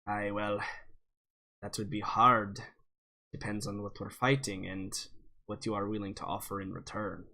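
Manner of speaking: put-on low, soft old dwarf with a Scottish lilt; a wary old dwarf naming terms. GM's dwarf bargaining with the cleric, low and wary. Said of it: "and he says".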